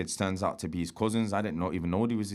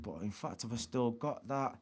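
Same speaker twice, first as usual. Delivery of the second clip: normal talking voice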